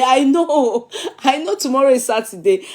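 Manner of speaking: amused, high-pitched protest; laughing. Real, not acted. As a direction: laugh through the words as you concede the slip. Laughs while conceding the wrong day.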